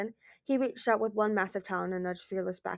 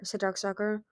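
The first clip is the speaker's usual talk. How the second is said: ordinary delivery, nothing marked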